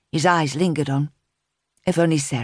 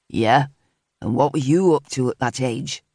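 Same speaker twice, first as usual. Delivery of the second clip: asking himself; 4 semitones lower than usual. A narrator's reading. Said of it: "he asked himself".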